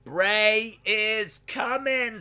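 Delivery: very slow, high, put-on voice; eerie announcement of a return; ominous reading. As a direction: stretch it out like a spooky warning. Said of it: "says Angie".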